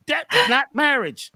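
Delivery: ordinary delivery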